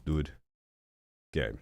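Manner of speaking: usual speaking voice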